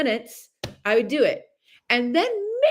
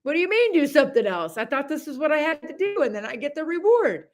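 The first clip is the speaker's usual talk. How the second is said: confused protest; 7 semitones higher than usual. Retold or imitated. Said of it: "And I'm like".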